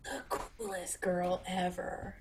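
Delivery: fading into a breathy whisper of awe; awed whisper. Real, not acted. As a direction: whisper it like she's too cool to say aloud. Gushing over cool character.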